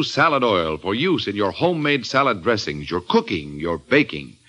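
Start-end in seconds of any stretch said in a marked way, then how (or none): none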